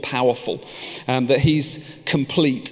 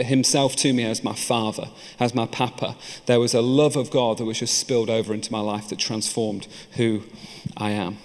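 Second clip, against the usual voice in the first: normal talking voice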